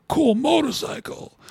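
high, slow, awestruck "Cool motorcycle"; dazed motorcycle guy. Retold or imitated. Biker in the sketch admiring a motorcycle, high and slow. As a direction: linger on it like he's seeing a miracle.

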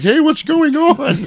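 very high, loud, drawn-out protest; hammed-up bewildered yelp; mock alarmed cry. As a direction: cry out slowly in a high put-on voice. Henchmen confused at real beating.

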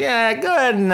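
high, eager ordering voice. Retold or imitated. Ordering at the bagel place, eager and high. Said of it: "I was like".

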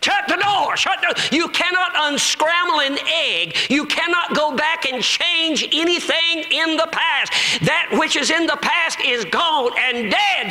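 high-pitched preaching cry; forceful exhortation. Retold or imitated. Preacher tells the woman to shut the door, forcefully exhorting. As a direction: drive each phrase home at high pitch.

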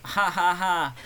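high mock laugh in separate syllables; Richard Miller impression. Mimicking the co-host's laugh, high and put-on. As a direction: fake a friend's high ha-ha laugh. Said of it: "do an impression of me".

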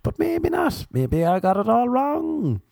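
high, slow sarcastic sing-song; mock sarcastic. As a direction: let the sarcasm ride up high and drawn out. Sarcastic reply to flatmate.

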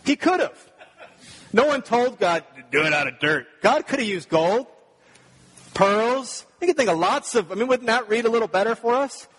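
loud, high, driving sermon; preaching at full force. Acted.

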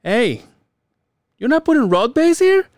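high, sharp questioning inspector; inspector's challenge. Retold or imitated. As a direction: mimic the inspector catching a mistake. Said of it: "and he goes".

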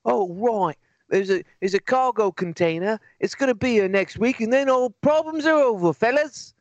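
high, breezy fake reassurance; high mocking corporate voice. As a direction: chirp it like a cheery company rep. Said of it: "it would be great if they're like".

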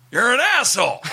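high-pitched mock heckle; retold heckler's jeer; heckler's insult. Retold biker heckling the comic.

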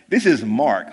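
ordinary talk, nothing marked

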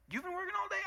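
высокий возмущённый переспрос; mock-indignant wife, pitch jumping up; indignant disbelief. Wife retorts in acted quarrel.

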